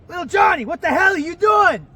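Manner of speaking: shouting high and loud at his son; furious shout. Retold or imitated. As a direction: bellow it in total shock. Dad catches son, shocked outburst.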